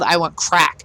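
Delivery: ordinary delivery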